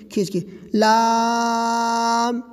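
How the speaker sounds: لام with an open mouth, the a held long; slow open لام; drawn-out laam. Shown as a demonstration. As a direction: stretch لام slowly.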